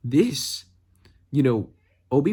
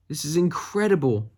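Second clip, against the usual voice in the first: really mean it this time; excited; 5 semitones higher than usual. Shown as a demonstration.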